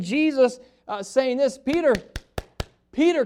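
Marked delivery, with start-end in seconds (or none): 1.6-3.2 put-on higher and louder, calling a child by name; calling like a parent. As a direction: call him back like a parent to a wandering kid. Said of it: "kinda like a, a parent does to his kid".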